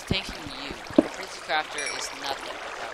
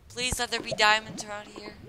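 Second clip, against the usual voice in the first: mock prayer; 7 semitones higher, 7 dB louder than usual. Shown as a demonstration. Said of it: "For example".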